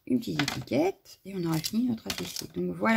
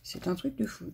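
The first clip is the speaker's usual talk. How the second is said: ordinary talk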